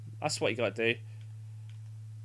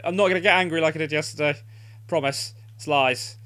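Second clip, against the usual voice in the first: ordinary talk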